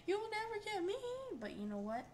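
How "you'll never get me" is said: high-pitched mocking taunt; taunting game character. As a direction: pitch it way up as a teasing dancer. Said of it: "she's dancing like".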